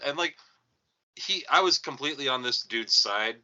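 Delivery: usual speaking voice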